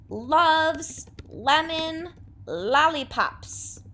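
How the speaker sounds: loves lemon lollipops stretched out very slowly; slow alliteration demo. Shown as a demonstration. Teacher modelling her own alliteration for the class, slowly. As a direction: draw out each L word slowly. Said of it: "if I wanted to write my own alliteration".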